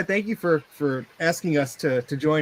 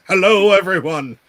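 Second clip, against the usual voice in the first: booming announcer welcome; 3 semitones higher, 7 dB louder than usual. Pretend. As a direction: announce it big to the crowd. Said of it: "Do you want me to do an announcer voice?".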